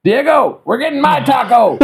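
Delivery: mock a food-obsessed colleague calling out; hollering coworker. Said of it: "he's like".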